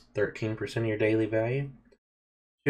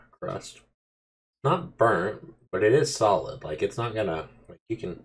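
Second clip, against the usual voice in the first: chewing while talking; talking between bites; mouth full of pizza. Real, not acted. Seen 0: Holds slice, bites at 1.7s, mouth working while speaking after.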